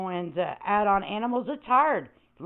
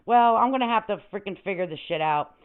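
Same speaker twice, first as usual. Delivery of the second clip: ordinary talk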